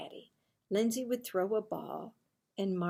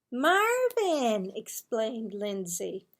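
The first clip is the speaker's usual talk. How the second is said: put-on high, loud four-year-old voice; small sister begging her big brother; little sister; 4 semitones higher, 6 dB louder than usual. A narrator's reading. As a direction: play an eager little girl. Little sister Lindsay asks.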